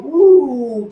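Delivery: long, rising-falling "woo"; mock whoop. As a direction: whoop like the money is pouring in. Mimics money rolling in from meters.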